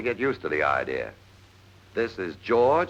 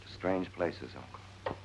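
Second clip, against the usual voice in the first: hushed; 10 dB quieter, slower than usual. Acted. Drunk mysterious answer.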